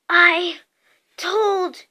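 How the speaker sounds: gasped words with gaps between them; winded, broken-up delivery; gasping. A narrator's reading. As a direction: catch your breath between words. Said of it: "Hermione gasped".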